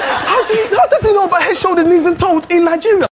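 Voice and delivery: high, amazed question; disbelief. Retold or imitated. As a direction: sound like you can't believe your eyes. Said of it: "I couldn't believe it".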